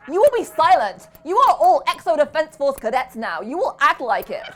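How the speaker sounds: loud, high barking commands; furious robotic drill-sergeant yell; annoyed drill shout. A narrator's reading. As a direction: bellow orders at the cadets. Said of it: "They shouted in one deep, annoyed American voice".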